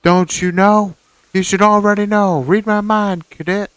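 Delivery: loud drill sergeant bark; barking gym coach. Pretend. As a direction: yell it like a drill instructor. Player mock drill sergeant yelling.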